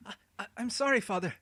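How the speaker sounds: high, frightened stammer; fearful. A narrator's reading. Boy caught by the old man, frightened apology. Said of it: "fearful of some punishment".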